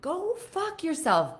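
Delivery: defiant retort. Retold or imitated. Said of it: "not being scared to be like".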